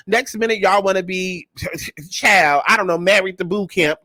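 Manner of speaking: ordinary delivery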